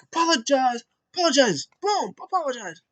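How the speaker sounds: high, hammed-up demand of an apology; squeaky mock-tough chant; mock demanding Murphy. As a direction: bark the word like a bully with a stick. Voicing Murphy beating Rollins.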